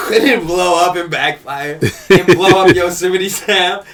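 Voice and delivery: laughing while telling. Real, not acted.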